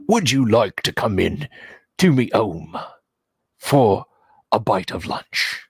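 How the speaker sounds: politely inviting ogre with a Cockney accent; genteel Cockney ogre. Shown as a demonstration. Ogre inviting adventurers home for lunch, genteel Cockney. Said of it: "an ogre with a Cockney voice".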